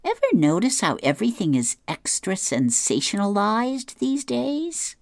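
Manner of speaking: high, lightly mocking woman's voice; Blondie's voice. Blondie to Dagwood about the news, high wry complaint. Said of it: "she says".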